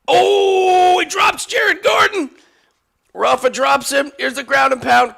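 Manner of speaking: high, loud excited yelling; excited shout. Real, not acted. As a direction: yell it as the fighter drops. Knockdown in live fight.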